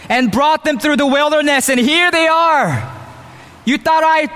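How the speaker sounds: a boastful man showing off to his father-in-law; boastful Moses. Pretend. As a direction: puff yourself up like a bragging hero. Imagined boastful Moses speech.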